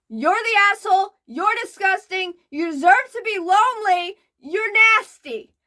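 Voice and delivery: loud, high disgusted scolding; furious scolding. Real, not acted. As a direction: hammer each insult. Scolding the poster.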